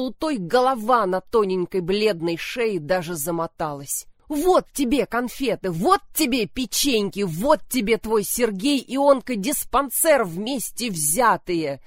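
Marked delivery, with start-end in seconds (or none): 4.3-11.8 loud, furious outburst, higher; furious shaking. Acted. Lyudmila, shaking her sister, bursts out furiously. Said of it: "затрясла так".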